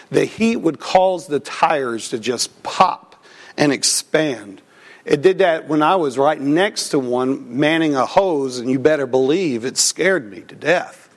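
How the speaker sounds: ordinary delivery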